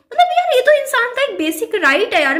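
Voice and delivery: real indignation, up high; indignant outburst. Real, not acted. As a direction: insist it's your basic right, voice rising. Venting about in-laws' restrictions.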